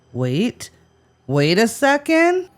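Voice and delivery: acted double take, climbing high at the end; dawning realization. Host as Jim spotting the silver, dawning surprise. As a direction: break off, then rise in mock surprise.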